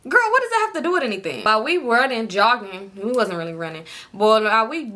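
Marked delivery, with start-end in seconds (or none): 0.1-1.4 fast, high exasperated aside. Real, not acted. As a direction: toss it off, exasperated.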